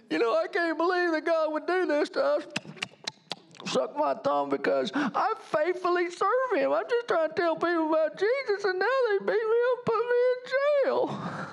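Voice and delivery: high whining voice, building to a tearful wail; whiny pouting. Acted. Acting out believers pouting at God, whiny mock wail. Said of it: "they could have gone into pouting one oh one".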